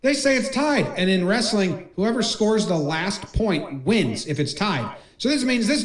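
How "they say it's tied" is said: high-pitched, urgent call to coaches; acted-out protest, pitched well up; alarmed appeal. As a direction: mimic a wrestler flagging down his coaches. Acts wrestler alerting his coaches.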